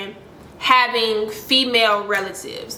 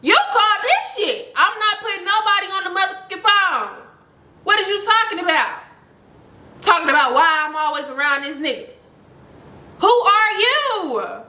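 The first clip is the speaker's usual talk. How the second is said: high-pitched, indignant comeback; irate telling-off; 8 semitones higher than usual. Retold or imitated. Storyteller replays telling off the girl on the phone, irate.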